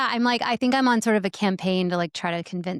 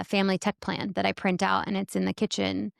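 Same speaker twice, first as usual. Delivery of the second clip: ordinary delivery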